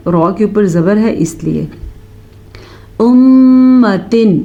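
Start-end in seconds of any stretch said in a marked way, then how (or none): 3.0-4.4 امتن with the doubled meem held in a long nasal hum; slow امتن, humming the ghunna on the meem. Shown as a demonstration. Teacher demonstrating the meem ghunna in امتن. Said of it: "میم کا غنہ ادا کریں".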